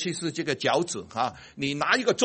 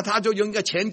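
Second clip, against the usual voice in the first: ordinary delivery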